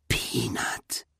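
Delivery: hushed, awed whisper. A narrator's reading. Girl finding the overgrown statue of Peanut, whispered. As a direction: whisper the name under your breath. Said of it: "wisperte sie".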